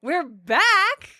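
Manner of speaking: high, rising incredulous question; feigned surprise. Acted. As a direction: play astonished at the news, voice jumping up. Feigned surprise in scripted exchange.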